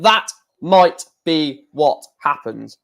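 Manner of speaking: slow, careful "That might be what happens"; measured correction, each word of "might be what happens" separate; deliberate retry. Shown as a demonstration. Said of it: "I can't even speak at the moment".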